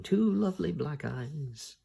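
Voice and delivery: a lightly voiced madman, slow and dreamy; madman's voice. A narrator's reading. Madman lost in a spell, slow and dreamy. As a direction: drift off, slow, lost in madness. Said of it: "falls into a state of madness again".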